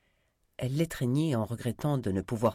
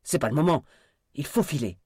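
raised, angry urgency; furious urging; 5 semitones higher, 6 dB louder than usual. A narrator's reading. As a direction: push him to get moving, loud and high. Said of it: "s'écria Kit furieux".

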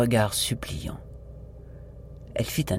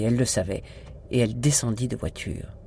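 usual speaking voice

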